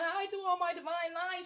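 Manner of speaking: high, mocking put-on voice of the politician; mocking imitation. Mocking the candidate's sorority boast, high mock voice. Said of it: "she says".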